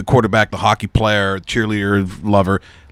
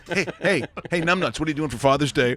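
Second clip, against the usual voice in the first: jeering taunt; 6 semitones higher, 4 dB louder than usual. Pretend. Mocking taunt at Trudeau.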